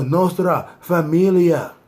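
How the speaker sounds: "Nostra Familia" said slowly, in Spanish style; Spanish-style pronunciation. Shown as a demonstration. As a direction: draw out "Nostra Familia" as members say it. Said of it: "I keep saying it like that".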